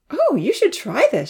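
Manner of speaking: put-on bright-pitched girl's eager exclamation; excited exclamation. A narrator's reading. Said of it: "she exclaimed".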